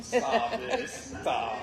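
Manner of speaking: laughing bashful protest. Real, not acted. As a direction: laugh it off shyly. Embarrassed by praise.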